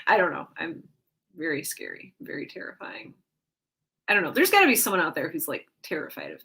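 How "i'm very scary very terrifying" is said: dropping to a hushed, spooky murmur; playfully menacing, fading into a breathless whisper; mock scary whisper. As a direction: pretend horror-movie hush. Claims to be scary.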